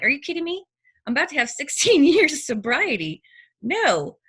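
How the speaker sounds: outraged disbelief at being asked about drugs; indignant disbelief. Retold or imitated. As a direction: push back, stunned and offended. Indignant retort to drug accusation.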